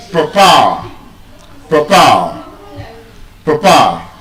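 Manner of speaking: "Papa" as puh-PAA, second syllable stressed; British pronunciation. Shown as a demonstration. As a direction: drill "Papa" with a schwa first and a long falling PAA. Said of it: "British pronunciation".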